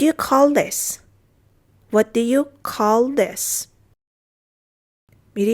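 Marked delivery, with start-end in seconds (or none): 1.9-3.7 slow, separated words; measured language-teacher demonstration; slow model repeat. Shown as a demonstration. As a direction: say it word by word for learners. Teacher repeats phrase slowly.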